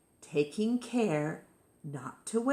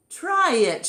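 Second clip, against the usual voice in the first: high, louder shout; 9 semitones higher, 7 dB louder than usual. Acted. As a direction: shout it with urging. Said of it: "shouted the others".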